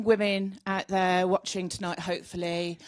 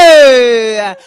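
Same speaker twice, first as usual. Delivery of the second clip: loud greeting; 18 dB louder than usual. Real, not acted. Comedian greets audience on entering.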